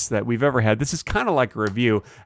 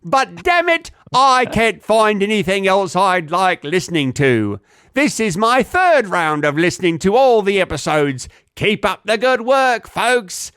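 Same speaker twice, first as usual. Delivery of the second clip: hammed-up British accent, high, loud and slow; exaggerated British voice, pitched up and drawn out; 5 semitones higher, 4 dB louder, slower than usual. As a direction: read it out as a theatrical Brit. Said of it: "read it in a British accent".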